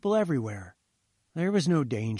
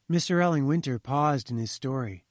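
plain reading voice

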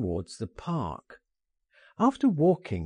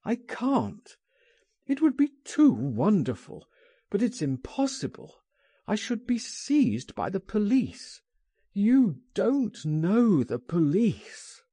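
fearful pleading; 8 semitones higher than usual. Acted. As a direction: beg him to understand the danger. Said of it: "he cried".

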